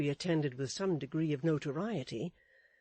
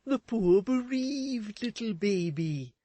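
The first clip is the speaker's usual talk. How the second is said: raised, fussy husband voice; Mister Chick's voice; 6 semitones higher, 6 dB louder, slower than usual. A narrator's reading. Husband fussing at his wife, in a raised fussy voice. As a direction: fuss over his wife anxiously.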